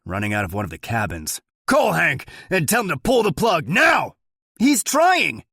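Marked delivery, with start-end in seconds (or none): 1.7-4.2 loud, high urgent shout. A narrator's reading. Sheriff yelling orders. 4.6-5.4 very high, loud frantic shout; shouting back. A narrator's reading. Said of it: "a deputy shouts back".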